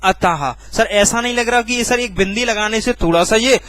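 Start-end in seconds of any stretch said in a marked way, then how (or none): 0.0-0.7 अतः with a clear final ha; visarga pronounced. Shown as a demonstration. Teacher repeating how visarga sounds, अतः with final ha. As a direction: add weight on the ha ending अतः. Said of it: "क्या बोलेंगे".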